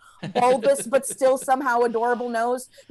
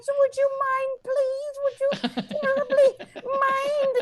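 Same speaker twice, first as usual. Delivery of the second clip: put-on very high, pleading little old lady voice; 12 semitones higher than usual. Old lady begging to cut in line, high and pleading. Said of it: "little old lady looks at me".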